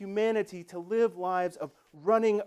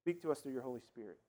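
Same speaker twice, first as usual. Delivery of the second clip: pastoral prayer; 5 semitones lower than usual. Acted. Pastor leads the congregation in prayer.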